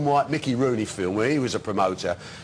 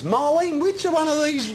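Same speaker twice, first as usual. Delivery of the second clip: much higher, fussy little voice; mock Bugner asking his wife; 13 semitones higher, slower than usual. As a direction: play him helpless over dressing gowns. Bugner asks wife about gown.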